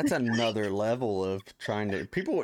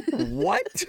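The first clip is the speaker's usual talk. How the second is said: high-pitched, laughing disbelief; laughing disbelief at the customer; incredulous what. Real, not acted. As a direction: shoot up in shock, laughing.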